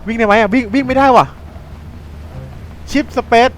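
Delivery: yelling as the wolves close in; real panic. Real, not acted. Chased by wolves in game. (ตื่นตระหนกจริง เสียงสูงและดัง)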